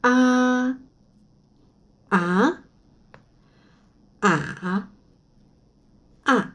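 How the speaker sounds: ah through the four tones in turn. Shown as a demonstration. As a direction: run ah through level, rising, dipping, falling. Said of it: "Donc, c'est". (les quatre tons sur ah)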